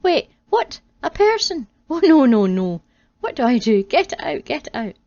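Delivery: high, loud rising panic; panicked. Acted. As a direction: beg in frantic fear. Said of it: "A look of fear".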